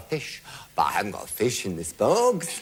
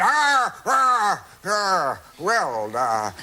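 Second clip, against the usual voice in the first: flustered stammer; 7 semitones higher, 4 dB louder, much slower than usual. Retold or imitated. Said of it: "no, no line".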